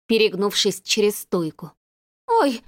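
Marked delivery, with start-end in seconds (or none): 2.3-2.6 startled cry. A narrator's reading. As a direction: gasp it out in shock. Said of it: "вскрикнула Людочка".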